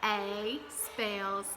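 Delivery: slow, letter by letter; slow spelling. Shown as a demonstration. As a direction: spell it out slowly for kids. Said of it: "teacher models spelling aloud".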